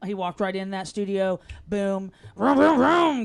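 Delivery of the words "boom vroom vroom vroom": engine vroom noises. Retold or imitated.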